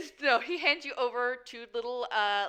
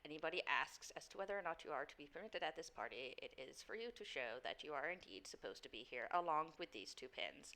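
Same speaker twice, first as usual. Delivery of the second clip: soft, lower, deferential servant voice; formal doorman; 6 semitones lower, 16 dB quieter than usual. Acted. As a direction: explain it with measured courtesy. Game master plays doorman.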